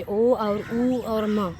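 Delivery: ओ, उ, म spoken slowly one by one; slow teacher demo of ओ और उ और म; sounding out Om. Shown as a demonstration. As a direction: break ओम into its three sounds, unhurried. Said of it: "ओम में तीन शब्द है".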